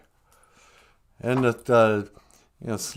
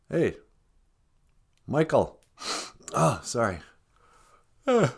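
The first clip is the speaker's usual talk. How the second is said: ordinary talk, nothing marked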